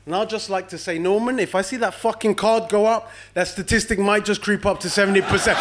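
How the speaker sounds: ordinary talk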